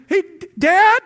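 imitating a kid's alarmed shout, high and loud; urgent child's call. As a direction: yell for Dad as if something's wrong. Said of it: "Dad goes on high alert".

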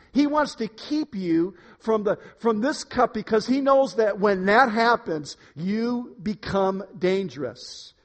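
ordinary talk

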